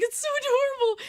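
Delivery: high, loud gushing squeal. Retold or imitated. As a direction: squeal it, charmed to bits. Gushing over the fan's innocent question.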